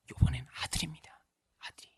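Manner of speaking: hushed, breathy whisper of a fortune teller; secretive whisper. Retold or imitated. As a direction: lean in and whisper the answer.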